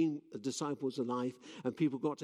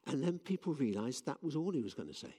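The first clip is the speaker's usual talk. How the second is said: ordinary talk, nothing marked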